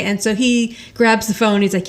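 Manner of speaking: ordinary talk, nothing marked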